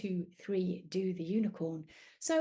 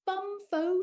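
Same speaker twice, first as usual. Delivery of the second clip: giant's shout; 10 semitones higher, 6 dB louder than usual. Acted. Giant chant.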